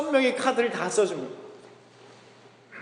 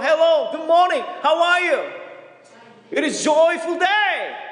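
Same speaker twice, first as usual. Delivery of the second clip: broken English greeting; 6 semitones higher, much slower than usual. Retold or imitated. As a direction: call it out in halting Korean-accented English. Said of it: "안 되는 영어로".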